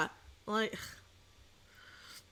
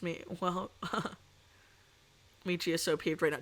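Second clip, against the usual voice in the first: normal talking voice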